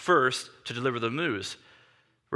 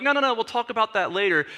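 higher, hasty deflection; brushing off; 6 semitones higher, faster than usual. Retold or imitated. Family members put off the triathlete's question, lightly brushing off. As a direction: wave the question away.

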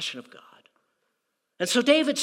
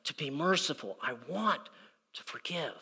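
much softer, tender; God's gentle wish to forgive, softly; 3 semitones lower, 8 dB quieter than usual. Retold or imitated. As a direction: let it fall away quietly. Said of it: "God's inclination is".

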